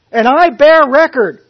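loud, high ringing testimony; confident declaration. Retold or imitated. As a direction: proclaim it with full conviction. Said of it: "with full confidence".

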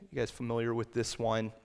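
normal talking voice

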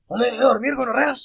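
loud, high imitation of an angry granddad yelling; grito de abuelo. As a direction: yell it like a fed-up grandpa at night. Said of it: "no sale su abuelito a gritarle". (grito agudo de abuelo furioso, imitado)

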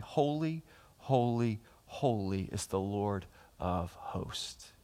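hushed reverent repetition. Acted. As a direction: let each "holy" sink into a hush. The same angels' call, repeated softly.

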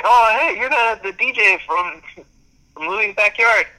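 high, excited re-enacted greeting. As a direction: call out to someone you just recognized.

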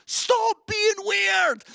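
slow, high, loud shout; shouted exhortation. Real, not acted. Preacher saying it to the church, slow loud shout. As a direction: holler each word at them.

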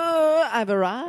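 lightly posh-guy voice; a hint of a sophisticated gent. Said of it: "a sophisticated guy going".

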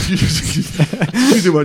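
slow apology through laughter; laughing apology. Real, not acted. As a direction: laugh while saying sorry.

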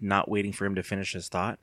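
ordinary delivery

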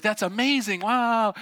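high, awed exclamation; imitated amazed crowd. Crowd watching tightrope walker cross the falls, imitated amazement. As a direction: gush like a wowed onlooker.

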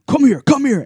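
high, mock-demanding call; retold aggressive summons. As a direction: imitate a drunk friend ordering you over. Retold drunk friend summoning him aggressively.

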